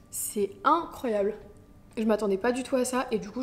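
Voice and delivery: ordinary talk, nothing marked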